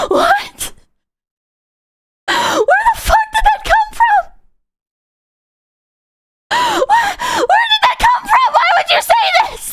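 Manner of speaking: high, laughing, half-shouted shock; laughing disbelief. Retold or imitated. Retelling his shocked reply to a coworker, laughing and yelling. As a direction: laugh through stunned outrage. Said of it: "And I'm like".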